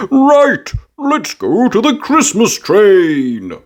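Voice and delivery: high, slow jolly daddy voice; an excited dad teasing the kids; playful, drawn-out fatherly invitation; Daddy Pig's voice. A narrator's reading.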